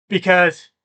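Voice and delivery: words stretched through a real yawn. Real, not acted. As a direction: say it mid-yawn, tired. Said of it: "oh, I'm sorry I'm yawning".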